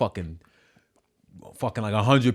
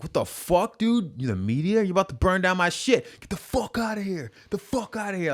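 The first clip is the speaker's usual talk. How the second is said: retold angry yell; 10 semitones higher than usual. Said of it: "Like, I was pissed".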